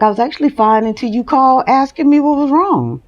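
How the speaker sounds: high, fast, fed-up mock complaint; exasperated. Replaying her reply to the friend, high, fast and exasperated. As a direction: throw the blame back, half laughing.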